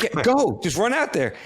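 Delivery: high, urgent push to get moving. Retold or imitated. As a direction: shoo him out onto the field. PR guy urging him onto field.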